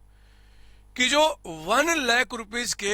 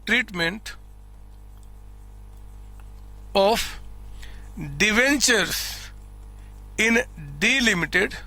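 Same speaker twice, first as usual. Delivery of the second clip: word-by-word dictation with long pauses; 2 semitones lower, much slower than usual. Shown as a demonstration. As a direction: dictate slowly enough for students to write. Dictates text for students to write.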